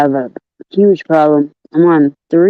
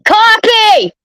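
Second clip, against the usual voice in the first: shouting a name; 12 semitones higher than usual. Real, not acted. Shouting for friend again.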